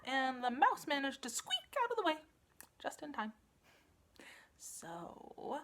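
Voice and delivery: lively read-aloud, big swings for effect; storybook narration. A narrator's reading. Storyteller reading the turnip tale to children, animated. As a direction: tell it like bedtime story theatre.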